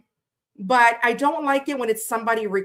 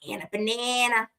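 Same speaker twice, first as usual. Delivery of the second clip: ordinary talk, nothing marked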